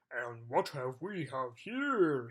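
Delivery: roaring giant. Acted. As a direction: boom it out like a huge jelly giant. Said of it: "he roared".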